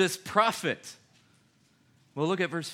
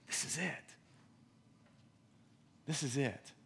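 breathless whispered wonder after a gasp; gasping awe; 13 dB quieter than usual. Retold or imitated. People seeing the sign, whispering in awe. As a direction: hush it like you can't believe it. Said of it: "and they go".

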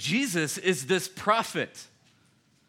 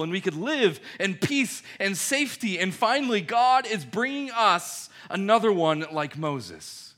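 loud, rising fervent hope; excited crowd; 4 semitones higher, 7 dB louder than usual. Retold or imitated. Preacher voicing hopeful crowd, building excitement. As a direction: swell it like a crowd seeing freedom.

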